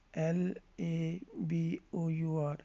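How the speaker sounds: slow, letter by letter; spelling out. Shown as a demonstration. Teacher spelling labour for learners, slow separate letters. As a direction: spell it out slowly with a gap after each letter.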